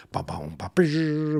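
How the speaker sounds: vocalized drums with buzzing crash; boomy mouth-drum hit and fizzing cymbal; imitated drum fill.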